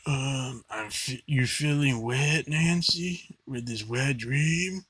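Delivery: slow, drawn-out mock dark voice; Freddy Krueger. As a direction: imitate a hammy movie villain, stretching the words. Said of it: "doing, like, a dark voice".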